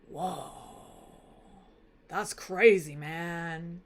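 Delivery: low, soft stunned drawl; a dude who can't believe it; laid-back amazed king. Acted. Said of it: "King Alcinous is like".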